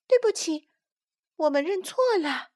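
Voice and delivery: high little tadpole apologizing; sheepish childlike apology; embarrassed. A narrator's reading. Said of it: "于是不好意思地说".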